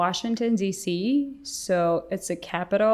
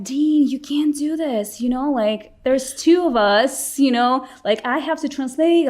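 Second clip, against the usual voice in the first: high, loud exasperated plea; impatient pleading; 6 semitones higher, 5 dB louder than usual. Retold or imitated. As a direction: urge him on, clearly frustrated. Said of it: "And I'm like".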